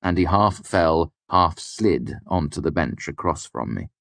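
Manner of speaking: ordinary delivery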